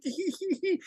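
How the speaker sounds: high, soft tittering hee hee; mock tittering. Podcaster mimics a giddy whispered tittering. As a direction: titter like a kid sharing a secret. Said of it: "and he's like".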